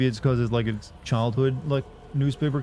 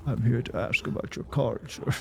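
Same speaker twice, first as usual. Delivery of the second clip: attempted death rattle; 5 dB quieter than usual. Shown as a demonstration. Streamer tries the game's creepy voice on a mundane line, soft death-rattle attempt. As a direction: try a quiet death-rattle voice and fall short. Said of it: "doesn't get death readily".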